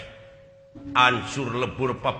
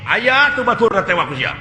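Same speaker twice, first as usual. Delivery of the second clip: loud; 9 semitones higher, 6 dB louder than usual. Acted. Acted fight scene.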